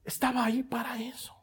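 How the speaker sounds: breathy hushed line; whisper. Real, not acted. Preacher revealing the storm's purpose, whispered. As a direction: whisper the point home.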